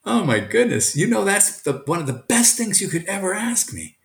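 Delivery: a touch of happy surprise; teacher beams back at his student, mildly excited; delighted. Retold or imitated. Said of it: "I said".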